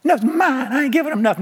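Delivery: mock childish refusal; selfish kid. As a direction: whine like a possessive kid. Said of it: "when your kids get one cookie".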